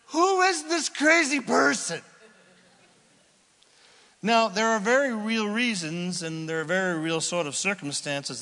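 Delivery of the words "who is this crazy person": high, startled "who is this" voice; a startled self, much higher and louder; shocked inner voice. Retold or imitated. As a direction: hear yourself and recoil. Inner voice mid-argument, shocked.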